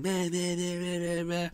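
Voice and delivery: high, nasal-free beeping imitation of a wailing alarm; high mock alarm meh-meh; imitated alarm beeping. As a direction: mimic the carbon monoxide alarm going off. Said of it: "it was going".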